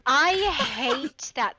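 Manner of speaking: loud and very slow, stretched out; loud drawn-out groan. Real, not acted. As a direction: drag it out in loud disgust. Reacting to hiccups, exaggerated.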